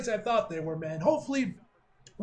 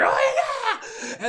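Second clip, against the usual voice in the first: high, loud battle yell; imitated wrestler's scream; 6 dB louder than usual. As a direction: scream it like a wrestler mid-fight. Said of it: "he just screamed at him".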